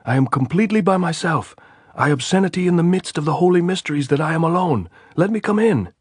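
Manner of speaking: high, loud answer across the wire; shouting. A narrator's reading. Andres answering the sentry's challenge, shouting. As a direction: yell it up so they hear.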